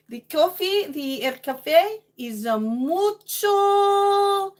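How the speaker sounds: high, loud hammed-up Spanish; playful Spanish accent with a long, sung-out ending; mock Spanish accent. Streamer trying out Spanish on viewers, hammed-up Spanish accent.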